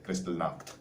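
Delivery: Kristallnacht said softly, ending in a hard "nakt"; quiet, careful German-style Kristallnacht; demonstrated German pronunciation. Shown as a demonstration. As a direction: show how to say Kristallnacht, with "Nacht" as "nakt". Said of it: "That's how you pronounce it".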